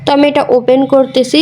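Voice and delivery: normal talking voice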